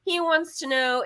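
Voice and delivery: ordinary talk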